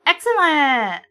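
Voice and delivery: a hint of a thrilled little kid. Shown as a demonstration. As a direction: say it like an excited child. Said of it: "a little kid would say".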